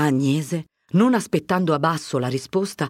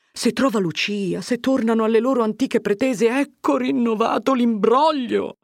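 rising, exasperated self-pitying rant; agitated fretting; 8 semitones higher, 4 dB louder than usual. A narrator's reading. Curate alone dreads the whole mess renewed, exasperated. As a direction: fret aloud, climbing higher and higher.

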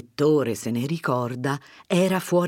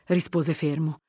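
ordinary delivery, nothing marked

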